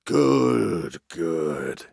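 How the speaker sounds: put-on drawn-out old-boss voice, falling away; relieved old chief. A narrator's reading. Old walrus chief calming down after Basil's assurance, relieved sigh. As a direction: sigh it out, sinking low on the second word. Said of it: "let out the air with a long sigh".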